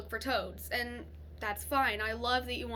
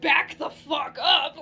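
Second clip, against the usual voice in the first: loud, drawn-out, laughing yell; mock outraged shout; 5 semitones higher, 12 dB louder, slower than usual. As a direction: holler a warning in playful outrage, laughter bubbling up. Mock reaction to a racy question.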